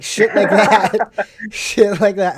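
loud laugh breaking into the words. Real, not acted. Friend cracking up at his own army story, laughing. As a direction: crack up while talking.

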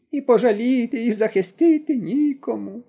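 high, slow plaintive moan. A narrator's reading. Granny moans that nobody protects her, high and plaintive. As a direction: groan it out, sorry for herself. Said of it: "простогнала баба Мокрина".

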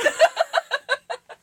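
a sudden high burst of laughter; real giggle. Real, not acted. Laughs at own line.

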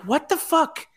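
high-pitched mock disbelief; incredulous outburst. Host reacting to adults crying over Disney hugs, incredulous. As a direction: squeal it in exasperated bafflement.

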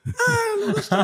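high whiny laughing complaint; imitating Narcisa. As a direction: whine it through a laugh. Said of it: "eu falei". (reclamação aguda e risonha imitando a Narcisa)

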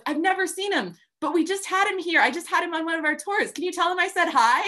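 very high, loud eager surfer; cheerful guy asking a favour; excited surfer bro. Retold or imitated.

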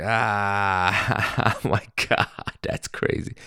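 forced polite laugh over the words; hammed-up fake laughter. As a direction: pretend to find the joke funny. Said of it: "that fake laugh".

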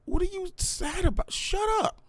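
high, scornful squeaky inner voice; a mock exasperated squeal in his head. In his head to depressed friends, high mocking squeal. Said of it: "I be like".

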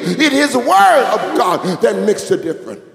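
loud, half-sung preaching; preaching at full force. Real, not acted. Pastor lifting the church about God's Word, loud sung preaching. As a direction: ring out each line like a chant.

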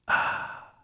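whispered, contented ah after a first sip; satisfied ah. Shown as a demonstration. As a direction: let out a soft, whispered ah of pleasure. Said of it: "And did you say".

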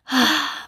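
loud weary sigh out; heavy sigh. Real, not acted. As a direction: sigh of despair. Sighs about job hunting.

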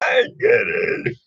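high, drawn-out cry of frustration; anguished cry. Real, not acted. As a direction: wail it out high and slow. Frustrated outburst.

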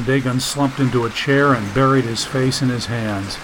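ordinary delivery

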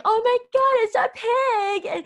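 loud high shocked cry; loud high horrified cry of a scared child. Retold or imitated. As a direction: gasp it out in horror as a kid. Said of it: "And I was standing like".

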